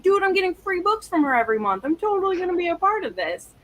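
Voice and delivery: reliving the joy of free books, voice jumping high; excited. Retold or imitated. Fan recalling joining a tiny Patreon, giddy and high. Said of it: "And I was like".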